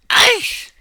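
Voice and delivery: sharp high yelp of mock pain. Vlogger pinching himself, with a hammed-up yelp.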